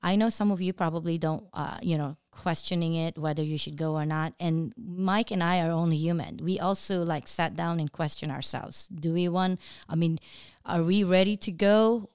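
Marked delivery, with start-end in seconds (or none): none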